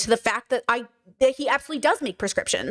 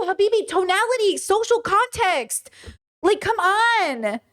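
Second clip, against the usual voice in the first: higher, very slow and pleading; passionate; 7 semitones higher, slower than usual. Real, not acted. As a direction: spell it out with exasperation. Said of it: "me getting loud and passionate".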